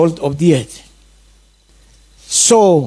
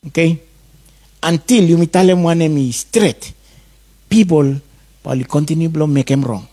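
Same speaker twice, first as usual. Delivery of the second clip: normal talking voice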